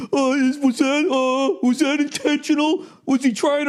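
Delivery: high, fussy mock official; mock refs. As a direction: fret like a prissy referee. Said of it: "They'd be like".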